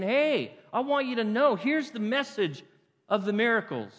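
high, loud, insistent call; emphatic appeal. Acted. Preacher voicing Jesus to the congregation, emphatic appeal. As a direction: call out urgently to make them listen.

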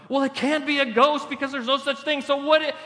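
high, fussy mock explainer; theologizing disciple. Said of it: "looking at it theologically".

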